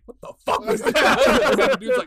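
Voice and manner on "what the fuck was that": high, breathy startled voice of the phone guy; startled stranger. Retold or imitated. A man on the phone at the urinal, lightly voiced startled. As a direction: sound caught off guard. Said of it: "the guy on the phone's like".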